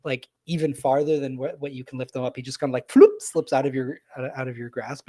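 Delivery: ordinary delivery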